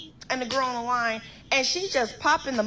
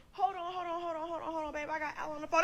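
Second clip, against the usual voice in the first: softer, quick-repeated hold-on; hushed placating; 4 semitones higher, 7 dB quieter, faster than usual. Retold or imitated. Boyfriend holding off his angry girlfriend, hushed placating. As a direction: placate in a small, soft, higher voice. Derek hushing her on the phone.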